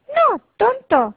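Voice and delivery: voz de rana muy aguda y lenta; regáñalo como ranita altanera; ranita burlona. Acted. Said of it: "respondió la ranita". (la ranita responde al cachorrito, voz aguda y burlona)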